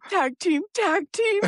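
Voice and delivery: high, urgent plea through suppressed giggles; stifled laughter. Retold or imitated. Husband calls from the bedroom for backup, trying not to laugh. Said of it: "trying not to die laughing".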